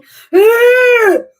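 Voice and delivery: long high-pitched laugh; loud high laugh. Real, not acted. Streamer laughing at his own joke, loud and high.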